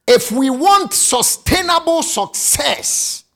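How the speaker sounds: slow, loud shouted preaching; full-force preaching. Acted. Preacher shouting to the congregation, slow and loud.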